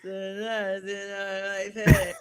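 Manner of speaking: mumbled through closed teeth, imitating him; clenched-teeth mock impression of the player; talking without moving teeth. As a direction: speak without opening the jaw, mock mumble. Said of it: "he doesn't move his teeth".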